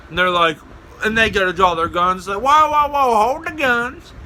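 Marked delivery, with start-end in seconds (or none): 2.4-4.1 high, hurried-sounding protest; mock alarm. Prankster as cops draw guns, mock-alarmed call. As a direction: call them off in hammed-up alarm.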